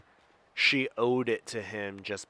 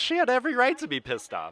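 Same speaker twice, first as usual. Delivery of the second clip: heated; 13 semitones higher, 3 dB louder, faster than usual. Real, not acted. Heated defense of the character.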